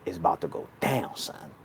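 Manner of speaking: low, quiet and calm, almost under the breath; a calm voice in his head; inner thought voice. Retold or imitated. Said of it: "a thought came in my head".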